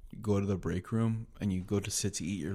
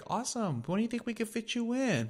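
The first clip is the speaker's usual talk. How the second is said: very high mock receptionist chirp; doctor's office caller; 14 semitones higher than usual. Doctor's office booking a patient, very high mocking voice. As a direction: imitate an appointment booker, pitched way up. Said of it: "And they'll be like".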